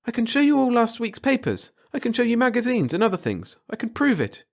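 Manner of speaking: high, urgent pleading; frantic. Acted. Frantic girl insists to Jack she can prove the date, high and urgent. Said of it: "She grew quite frantic at that".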